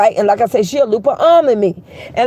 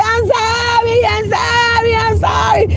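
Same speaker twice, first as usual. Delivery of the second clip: pleading apology; 9 semitones higher, slower than usual. Retold or imitated. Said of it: "And he said".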